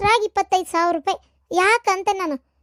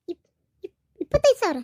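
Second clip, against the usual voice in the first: stammering, then a higher shocked question; gasping stammer into a shocked question; stunned disbelief; 4 semitones higher than usual. Acted. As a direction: choke on the number in disbelief. Shocked to hear of the money.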